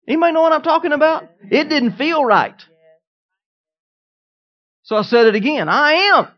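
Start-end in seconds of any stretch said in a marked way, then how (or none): 5.7-6.3 "am" punched high and loud; loud rising push on "am"; stress on AM. Retold or imitated. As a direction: declare it, hitting "am" hard. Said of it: "Emphasizing every single word".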